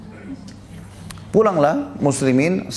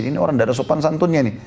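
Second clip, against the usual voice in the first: ordinary talk, nothing marked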